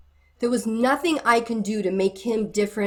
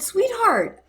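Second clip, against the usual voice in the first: tender sweetheart; 12 semitones higher than usual. Retold or imitated. Advising a young wife, retold.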